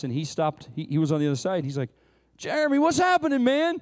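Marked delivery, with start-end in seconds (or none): 2.4-3.8 higher, bright hey-man hail across the pump; imitating a friend's upbeat hello; cheery buddy greeting. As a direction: call out like an old pal.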